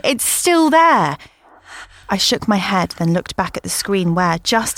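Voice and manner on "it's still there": alarmed. Acted. Insisting in alarm.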